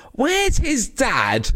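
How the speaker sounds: very high, drawn-out imitation of a child; mock a kid blurting it out across a restaurant; really loud child. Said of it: "really loud in the restaurant".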